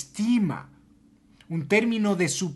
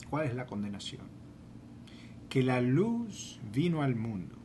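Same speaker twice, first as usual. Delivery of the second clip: quiet; 4 semitones lower, 9 dB quieter than usual. Acted. Reading scripture softly, dropping.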